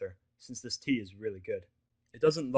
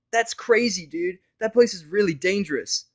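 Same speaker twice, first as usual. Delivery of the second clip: higher, louder alarmed mare; Vinyl's voice; 9 semitones higher, 13 dB louder, slower than usual. Acted. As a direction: warn him the place is deadly. Vinyl warns him.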